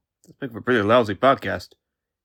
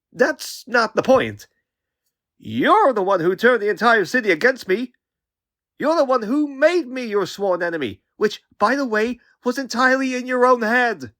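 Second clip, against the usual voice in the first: higher-pitched, heated accusation; indignant; 8 semitones higher than usual. Acted. Young hero blaming the man who attacked him, indignant. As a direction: throw the blame back, pitched high.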